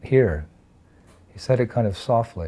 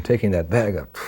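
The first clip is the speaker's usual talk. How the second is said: usual speaking voice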